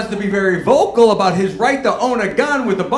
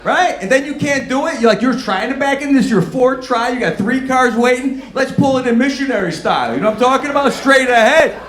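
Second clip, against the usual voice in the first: mock rant; 7 semitones higher, 4 dB louder than usual. Mocking the bad parker.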